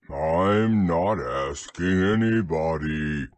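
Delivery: low, loud, slow donkey; deep, ponderous rebuff; grumpy Eeyore. A narrator's reading. Donkey cuts off Owl, loud low grumble.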